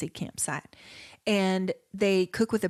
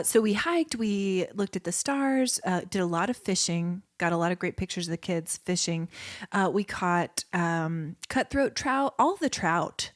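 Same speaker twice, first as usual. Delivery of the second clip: ordinary talk, nothing marked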